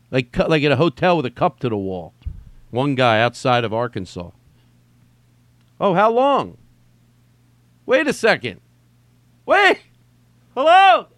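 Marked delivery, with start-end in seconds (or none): 5.8-11.1 high, loud shout building to a yell; calling out. Real, not acted. Host calling to someone off-mic about timing, shouting higher. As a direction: call through to another room.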